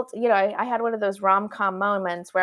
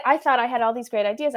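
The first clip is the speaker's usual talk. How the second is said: normal talking voice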